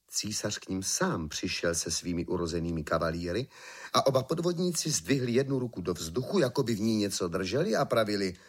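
ordinary delivery

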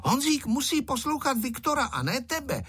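put-on high-pitched, stern little girl. A narrator's reading. Girl telling off the freckled boy, stern. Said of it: "řekla přísným hlasem Tereska".

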